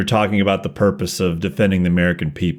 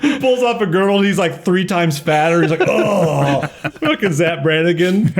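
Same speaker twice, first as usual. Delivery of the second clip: excited laughing; 8 semitones higher than usual. Real, not acted. Laughing riff about disguise.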